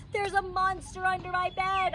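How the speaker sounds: put-on high scared child yell. A narrator's reading. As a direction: warn grandma in a panic. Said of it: "I yelled".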